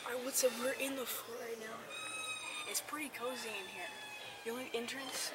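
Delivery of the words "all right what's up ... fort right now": ordinary delivery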